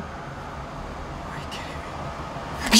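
very slow, drawn-out whisper; slow stunned whisper. Real, not acted. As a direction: stretch the whisper out in disbelief. Staring into the dark.

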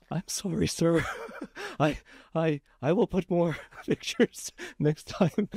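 high, giggly apology to a critic; laughing mock apology. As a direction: apologise through laughter, voice climbing.

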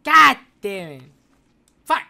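furious yell; angry outburst. Real, not acted. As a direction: yell it in frustration. Frustrated gamer loses.

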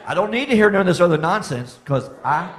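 loud, high, ringing preacher's cry; full-throated pulpit preaching; preaching at full force. Acted. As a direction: shout it out to the church like a revival preacher. Preaching exclamation to congregation.

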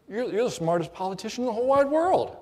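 put-on a touch of a starstruck boy; Boy Scout. Boy Scout voiced in joke.

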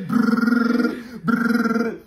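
high rolled brrr for pelting rain; imitated rain rattle. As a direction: make the rain sound like bullets. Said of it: "you don't hear the".

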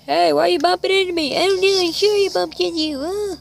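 high, fast little critter voice; squeaky bug voices. Pretend. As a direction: voice the ladybugs bickering. Voicing ladybugs squeakily.

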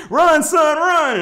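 high, loud shout from the sideline; excited dad hollering, drawn out; cheering football dad. Acted. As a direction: yell your kid on down the field. Imagined dad filming his son's game.

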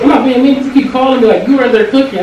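loud, high protesting voice; defensive and irritated. Acted. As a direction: insist you're not being mean. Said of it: "Why you being so mean?".

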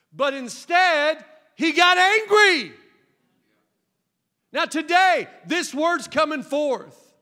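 high, loud, drawn-out preacher's cry; sermon climax, pastor crying out to the church; full-force preaching. Acted.

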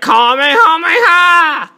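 high, drawn-out battle cry; mock anime attack shout. Shown as a demonstration. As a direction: shout it like a dubbed anime hero's special move. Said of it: "like this, like".

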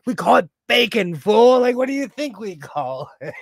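playful imitation of an annoyed comedian; mock indignant Tom Green. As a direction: mimic an exasperated Canadian snapping back. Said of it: "He's like".

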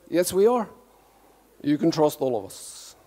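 lightly sing-song Norwegian English; a hint of a Norwegian lilt; Norwegian accent. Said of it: "in a Norwegian accent".

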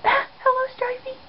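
very high, startled little voice; caught captive. Acted. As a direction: squeak a greeting as if caught out. Captive caught, feigns innocence.

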